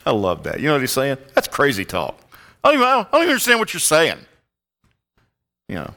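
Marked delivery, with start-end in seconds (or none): 2.6-4.2 high, rapid, louder sputter; flustered denial. Acted. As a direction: protest in a much higher, hurried voice. Mocking Peter's indignant denial of knowing.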